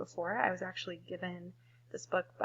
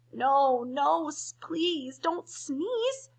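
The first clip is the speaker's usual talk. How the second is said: higher, drawn-out begging; mock pleading cry; 7 semitones higher, 4 dB louder, much slower than usual. Monkeys in the trees beg the elephant, stretched-out pleading cry. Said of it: "cried the monkeys in the trees".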